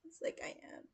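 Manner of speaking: unvoiced whisper aside. Real, not acted. Aside to viewers, in a whisper. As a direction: breathe it, no voice.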